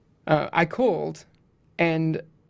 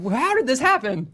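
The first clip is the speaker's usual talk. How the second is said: astonished disbelief; 9 semitones higher, 5 dB louder than usual. Retold or imitated. Retelling own amazement at coworker.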